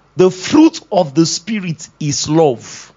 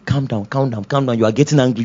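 soothing; 6 semitones lower than usual. Acted. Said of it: "the Holy Ghost is telling you".

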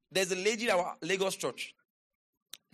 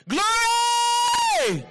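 long, high, loud cry; shout of praise; 11 dB louder than usual. Real, not acted. Preacher praising before the congregation, long high shout.

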